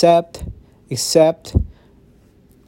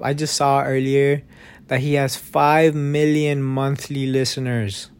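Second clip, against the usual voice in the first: usual speaking voice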